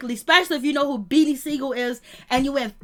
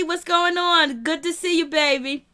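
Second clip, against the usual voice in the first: ordinary delivery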